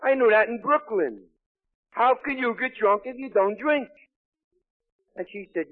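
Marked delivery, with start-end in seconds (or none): 0.0-3.9 loud, high-pitched retold indignation; indignant outburst. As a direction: protest in loud, raised-pitch disbelief. Indignant outburst at wife in meeting.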